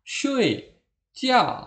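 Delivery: SHUI, JIAO high and sharply falling; both pressed down. Shown as a demonstration. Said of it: "Jadi dua-duanya ditekan". (SHUI, JIAO ditekan, nada jatuh)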